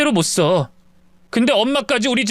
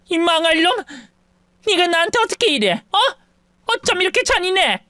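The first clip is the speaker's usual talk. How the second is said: higher, hurt outrage; outraged mother; 6 semitones higher than usual. A narrator's reading. Mother cursing her cruel son, outraged. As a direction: curse the son, wounded.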